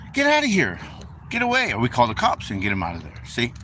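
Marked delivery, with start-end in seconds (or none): 0.1-1.7 high, mock-indignant get out of here; shooing someone off. Churchgoer shooing a homeless person off the porch, mock indignant. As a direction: pitch it way up like a homeowner shooing a stranger. Mimicking people chasing away homeless.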